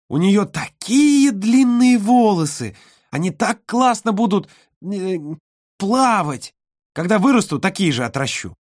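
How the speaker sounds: put-on high, excited little girl. A narrator's reading. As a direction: gush like an eager little girl.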